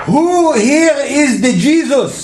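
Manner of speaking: put-on high, slow Israeli accent yelling across a store; heavy Israeli accent. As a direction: shout it in a thick Israeli accent. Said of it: "at the top of his lungs in that fucked up accent".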